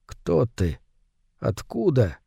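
soft, barely voiced questions; hushed wondering. A narrator's reading. Moore staring at the submarine, hushed questioning. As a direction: ask it very softly, in awe.

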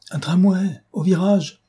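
high, panicky warning; frightened passenger, pitch jumping up; alarmed cry. A narrator's reading. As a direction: call out the obstacle in fright. Passenger sees tramway at the bend.

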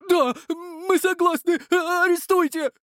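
very high slow desperate cry; terrified shouting. Acted. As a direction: shout in sheer terror. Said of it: "кричали в ужасе мужчины". (крик в ужасе)

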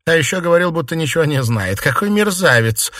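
high, loud denunciation; indignant. A narrator's reading. Mistress denouncing the lying servant to her maids, indignant.

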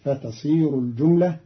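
normal talking voice

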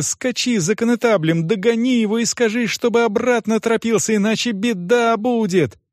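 put-on high-pitched, insistent girl; urgent pleading without shouting; begging. A narrator's reading. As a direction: implore him to hurry. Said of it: "стала умолять девочка".

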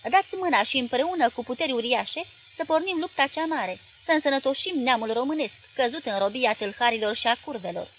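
plain reading voice

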